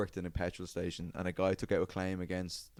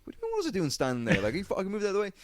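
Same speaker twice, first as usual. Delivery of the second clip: higher, fast, hammed-up outrage; indignant retort; 11 semitones higher, much faster than usual. To the security guard, fast mock indignant comeback. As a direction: throw the blame back, quick and huffy.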